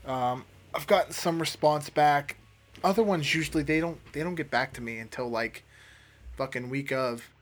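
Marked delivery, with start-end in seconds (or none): none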